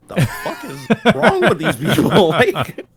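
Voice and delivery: laughing through an incredulous question; half-laughing, slowed disbelief; slow, laughing what-the-hell. Retold or imitated. Incredulous retold reaction.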